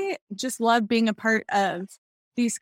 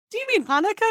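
sudden realization; 10 semitones higher, faster than usual. Retold or imitated. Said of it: "And I finally went".

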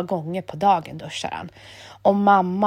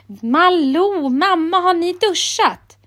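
higher, louder call through the house; 9 semitones higher, 4 dB louder, slower than usual. A narrator's reading. Emil calling his mother and sister, raised voice. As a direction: call to the family from the bathroom.

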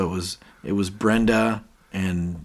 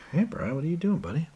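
chirpy mock greeting; 9 semitones higher, 5 dB quieter, faster than usual. Brenda voiced as chirpy greeting.

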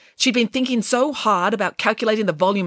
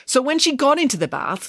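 usual speaking voice

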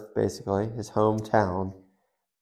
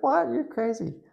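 mock wife voice, much higher and dismissive; skeptical wife. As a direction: squeak it out like a wife brushing off a crazy husband. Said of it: "She'd be like".

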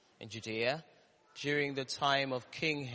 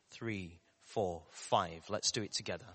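ordinary talk

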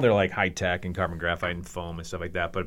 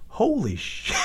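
high-pitched stunned reaction; acted astonishment; 12 semitones higher, slower than usual. Rival seeing the staple in the leg, high imitated shock. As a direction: imitate a friend who can't believe it.

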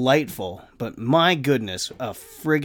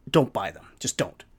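normal talking voice

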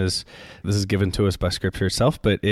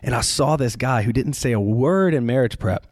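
ordinary talk, nothing marked

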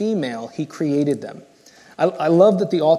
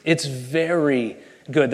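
slow, savouring delight; slow, tender "It's very good"; emphatic pleasure; 2 semitones lower, slower than usual. Acted. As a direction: linger on each word like a proud father. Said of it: "this emphatic pleasure that he takes".

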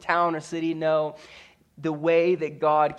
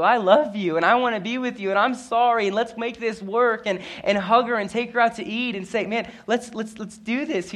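higher and louder, an earnest plea of love; pleading declaration; 7 semitones higher, 5 dB louder than usual. Pretend. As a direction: beg her to come home, voice lifted high. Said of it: "look her in the eyes and tell you".

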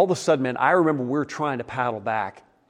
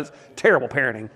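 normal talking voice

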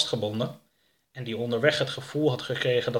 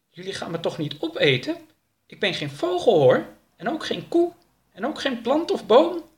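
put-on much higher, childlike-pitched character voice; protesting alien captive; 12 semitones higher, 4 dB louder than usual. Captive Oroka to his captors, high protest. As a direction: plead in a high, lifted voice.

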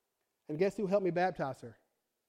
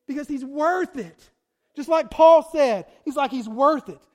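much louder, slow, emphatic declaration; preaching climax; 5 semitones higher, 10 dB louder than usual. Real, not acted. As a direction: lean in hard on worth it.

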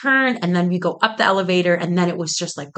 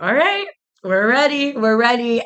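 lightly mimicked production guy, pitched up; higher, sing-out cue as if calling the crew; imitated crew call; 5 semitones higher than usual. Production guy cueing contestants before the doors open, imitated call.

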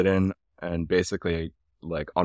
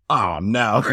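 dismayed mock-Aussie groan, louder; bad Australian accent; 3 semitones higher, 6 dB louder than usual. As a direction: act the Australian guide's dismay. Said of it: "I'm gonna do a bad Australian accent".

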